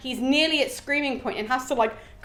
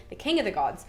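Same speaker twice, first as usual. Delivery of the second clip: ordinary delivery, nothing marked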